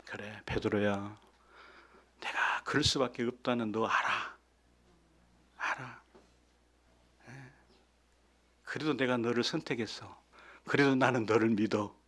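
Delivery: Jesus quietly reassuring Peter; tender Jesus. Pretend. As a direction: gently tell Peter you still choose him. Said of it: "그러셨을까요?".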